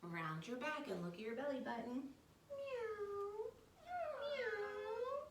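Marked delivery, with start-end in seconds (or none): 2.5-5.3 much higher, drawn-out rising kitty meow; cat meow. Pretend. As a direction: meow sweetly like a little cat. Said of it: "look at our belly button while we meow like a cat".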